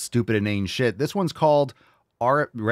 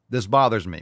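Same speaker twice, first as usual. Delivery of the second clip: ordinary delivery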